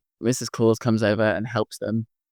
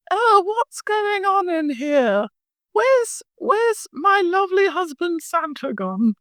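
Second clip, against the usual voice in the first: put-on high, slow old lady voice, fussing; Mrs Claus voice; slower than usual. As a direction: play a fretful old Mrs Claus, much higher. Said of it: "Missus Claus comes in and goes".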